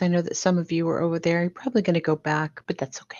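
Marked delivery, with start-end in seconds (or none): 2.7-3.2 soft whisper; whispered reassurance. Real, not acted. Host telling viewers it's okay to leave, whispered. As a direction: whisper it kindly, letting them go.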